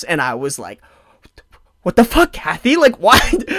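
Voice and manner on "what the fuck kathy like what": higher and louder incredulous outburst; hammed-up shock at mom; mock disbelief. Son reacting to mom's address, mock incredulous outburst.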